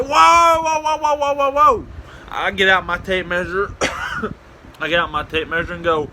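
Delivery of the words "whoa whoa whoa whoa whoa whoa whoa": high, loud string of halts; mock alarm. Prankster halting cops rushing back, high alarmed call. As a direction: rattle it off high to stop a crowd.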